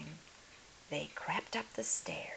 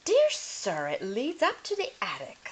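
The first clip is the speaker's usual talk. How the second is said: high, loud, emphatic governess; emphatic Miss Clavel; 5 semitones higher, 9 dB louder than usual. Acted. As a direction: insist firmly to the lord. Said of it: "her voice emphatic".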